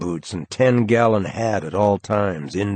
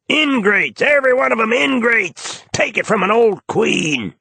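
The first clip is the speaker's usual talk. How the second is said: much higher, louder camp wail; A J lamenting; 14 semitones higher, 4 dB louder than usual. A narrator's reading. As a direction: sigh it out like a wounded old queen. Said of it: "A J".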